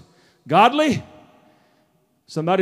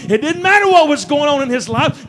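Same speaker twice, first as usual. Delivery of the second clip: raised, driving sermon rhythm; full-force preaching; 4 semitones higher than usual. Acted. Preacher declaring God's plan to church, full-force preaching. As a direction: build fervently to a proclamation.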